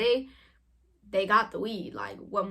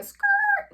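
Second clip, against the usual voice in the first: drawn-out skrrt; imitated tyre screech; 6 dB louder than usual. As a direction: stretch the word into squealing tyres. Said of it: "like, the skirt noises".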